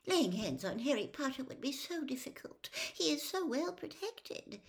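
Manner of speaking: high, thin pleading voice; timid squeaky servant. Acted. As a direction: plead anxiously with your master, high-voiced.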